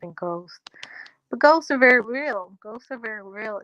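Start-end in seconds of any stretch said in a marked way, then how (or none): 1.3-2.5 louder, insistent statement; emphatic. Real, not acted. Repeats the claim for emphasis. 2.6-3.6 softer, settled repeat; quiet. Real, not acted. Repeats the claim for emphasis.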